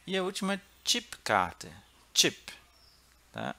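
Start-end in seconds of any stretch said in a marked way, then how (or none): 0.9-2.5 "Chipkarte" starting with a crisp "tch"; English-style ch. Shown as a demonstration. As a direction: pop the "tch" of "Chip" as in English. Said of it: "Como no inglês". ("Chip" com tch, como no inglês)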